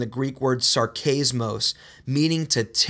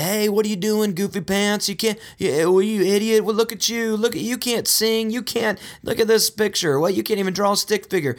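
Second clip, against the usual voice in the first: higher, needling taunt; mock sarcastic jabs, pitched up; mocking sarcasm; 7 semitones higher than usual. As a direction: poke fun like a playground bully. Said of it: "There is no time ever to sit there and say".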